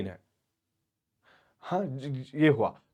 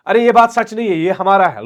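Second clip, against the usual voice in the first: a raised, higher protest; mock outburst; 6 semitones higher, 7 dB louder than usual. Natalia protesting to Lomov, loud and higher. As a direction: push back loudly on her claim. Natalia retorts in retold quarrel.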